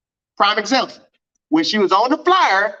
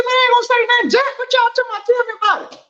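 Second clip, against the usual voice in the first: eager promise; 13 semitones higher, faster than usual. Retold or imitated. Promising his friends silence, eager and high-pitched. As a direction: swear it, dying to know. Retold swearing secrecy, eager.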